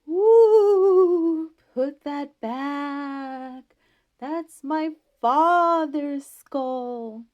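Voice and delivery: high, very slow wailing ghost pulling out every word; ghost's voice. A narrator's reading. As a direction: moan it like a hidden ghost, loud and drawn out. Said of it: "that old fellow hiding behind the door started in".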